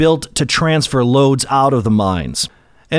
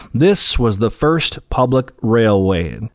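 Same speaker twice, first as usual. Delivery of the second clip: normal talking voice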